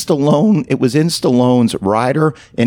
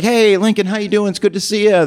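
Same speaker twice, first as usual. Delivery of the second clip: high-pitched waitress greeting a regular; friendly waitress; 8 semitones higher than usual. Acted. Waitress welcoming Lincoln at the counter, friendly waitress. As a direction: greet a favourite customer, pitched up.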